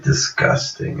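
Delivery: low, breathy disgusted mutter. Retold or imitated. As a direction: spit it out under your breath. Reacting to coughing girl.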